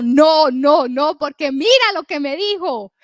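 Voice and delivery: loud, stubborn no no no; retold loud refusal. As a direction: push back hard, indignant. Discusión con el Espíritu recordada. (negativa fuerte e indignada)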